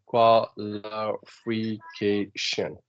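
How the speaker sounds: "qualification" very slowly, broken into qua-li-fi-ca-tion; syllable by syllable. Shown as a demonstration. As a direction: sound out "qualification" one syllable at a time. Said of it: "So when I'm saying syllable".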